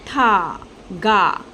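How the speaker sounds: teacher stretching tha and ga apart; syllable by syllable. Shown as a demonstration. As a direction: sound out tha, pause, then ga. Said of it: "So this becomes".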